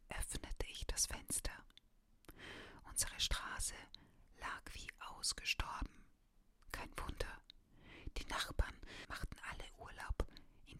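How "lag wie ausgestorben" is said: usual speaking voice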